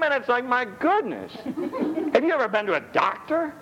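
high, animated comic riff voice; comic storytelling. Acted. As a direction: ham up the doctor visit for laughs. Animated comic doctor-visit riff.

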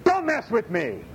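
slow, stern Jesus warning, lightly voiced; a calm but firm don't-cross-me from Jesus. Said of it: "I see Jesus going".

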